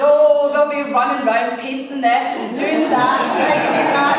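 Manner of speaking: sing-song mocking. Mocking the pre-visit cleanup.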